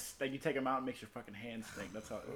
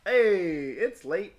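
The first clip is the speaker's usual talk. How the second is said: loud call; 7 semitones higher, 13 dB louder, much slower than usual. Acted. Calling out they are late.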